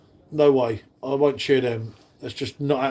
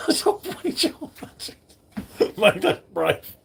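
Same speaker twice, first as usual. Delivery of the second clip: high, breathy laugh through the words; 5 semitones higher, 3 dB quieter, slower than usual. Real, not acted. Reacting to a funny chat message, laughing through it. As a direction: laugh as you say it.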